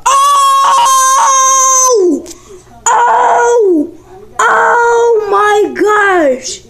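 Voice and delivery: high, loud cries of joy; excited shout. Real, not acted. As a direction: scream it in disbelief. Flip lands, shouts.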